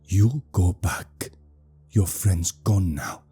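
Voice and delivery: slow, halting command in broken English; leader's broken English. A narrator's reading. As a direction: leader ordering them off, word by word. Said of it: "the leader says in plain English".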